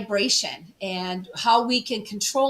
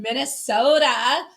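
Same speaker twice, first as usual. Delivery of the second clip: rounded Upper Midwest "Minnesota"; Minnesota accent; slower than usual. Real, not acted. Guest naming her home state, Minnesota accent showing. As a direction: let the Minnesota accent slip out on the name. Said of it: "sometimes my accent comes out".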